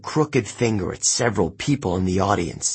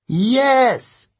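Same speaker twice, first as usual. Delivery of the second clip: high, loud cheering answer; long, high group yell; crowd shout; 5 dB louder than usual. Acted. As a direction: shout back like a whole crowd. Crowd of campers answers Tantalus.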